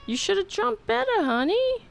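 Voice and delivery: high sing-song pet scolding. Real, not acted. Teasing the cat who fell.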